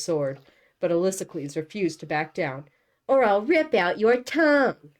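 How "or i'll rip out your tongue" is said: high, loud, acted menace; angry threat. A narrator's reading. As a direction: threaten with full-voiced fury. Angry threat in a voiced quarrel.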